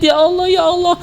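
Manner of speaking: high-pitched mock wail at God; hammed-up lovesick crying prayer. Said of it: "antum nangis, menjerit". (meratap pura-pura dengan suara tinggi)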